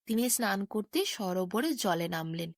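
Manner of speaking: usual speaking voice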